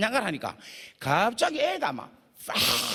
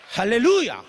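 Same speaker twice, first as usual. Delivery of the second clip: loud hallelujah; 3 semitones higher, 7 dB louder, slower than usual. Acted. Preacher's exultant cry.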